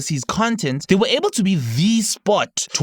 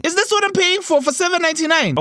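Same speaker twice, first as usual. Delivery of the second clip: indignant; faster than usual. Acted. Outraged at price.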